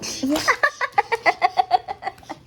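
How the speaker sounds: giggling, being tickled; squealing laughter from tickling; laughing while tickled. Real, not acted. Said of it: "Stop tickling me".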